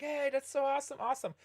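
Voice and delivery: high-pitched gushing fan, imitated; excited fan. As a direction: mimic a starstruck Marine gushing. Marine freaking out over meeting them.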